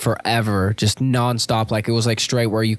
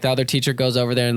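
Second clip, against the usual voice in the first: ordinary talk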